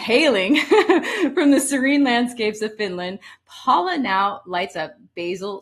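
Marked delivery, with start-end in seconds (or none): 0.0-3.2 reading on with a laugh after the stumble; laughing through it. Real, not acted. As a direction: let the laugh carry into the words. Laughs over own stumble.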